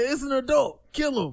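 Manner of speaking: mock kid shout. Retold folks yelling at adult.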